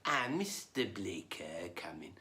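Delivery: slow, low rabbi welcome, sinking to a hush; Yinglish-speaking rabbi. Acted. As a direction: draw it out like an old-world Yiddish rabbi. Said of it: "going to the rabbi's house".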